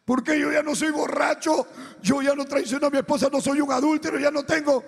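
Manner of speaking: high, loud, pleading self-defence; tearful protest. Retold or imitated. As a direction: cry out your innocence to God. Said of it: "llorando le decía".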